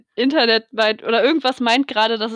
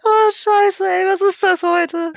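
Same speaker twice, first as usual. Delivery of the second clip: high-pitched, stretched by lag; glitchy connection; 8 semitones higher, 3 dB louder, slower than usual. Real, not acted. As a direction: voice warped by a bad line. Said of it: "Wie ihre Worte langgezogen wurden und hochgepitcht".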